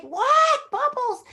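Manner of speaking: very high, loud squeal of disbelief; astonished. Retold or imitated. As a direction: re-enact stunned surprise at top pitch. Retells shock at the role.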